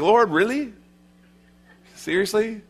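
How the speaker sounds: high-pitched mock disbelief, with a pause between questions; incredulous. Ananias objects to the Lord's order, incredulous. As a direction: question it high, as if it can't be true.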